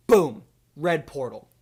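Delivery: ordinary talk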